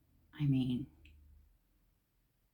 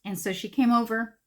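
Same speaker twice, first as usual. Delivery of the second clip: ordinary talk, nothing marked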